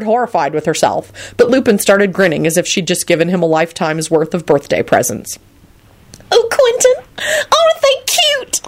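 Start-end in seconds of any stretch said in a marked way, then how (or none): 6.3-8.6 very high, drawn-out delighted squeal; excited outburst. A narrator's reading. Solange gushing to Quentin about the couple, excited squeal. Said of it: "Solange burst in excitedly".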